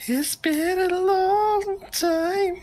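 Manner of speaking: very high, slow drawn-out voice; magical voice. Pretend. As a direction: stretch it out high like a spell. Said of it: "Какой волшебный голос?". (волшебный голос)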